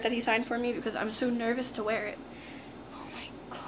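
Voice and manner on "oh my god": very soft, fully whispered exclamation; awed whisper. Real, not acted. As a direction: whisper it, overwhelmed. Overwhelmed by the signed merch.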